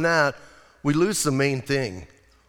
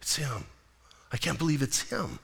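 softer, hushed awe; a hushed, softer it's him; awed disbelief; 4 semitones lower, 10 dB quieter than usual. Pretend. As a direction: breathe it out in wonder. Imagined seeing risen Jesus.

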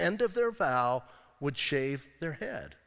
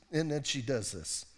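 usual speaking voice

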